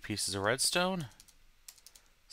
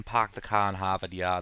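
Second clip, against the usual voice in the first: put-on Boston accent dropping every R; hammed non-rhotic Boston accent; crappy Boston accent; 2 semitones lower, 3 dB louder than usual. Shown as a demonstration. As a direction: do a bad Boston "pahk the cah". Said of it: "I have a really crappy Boston accent".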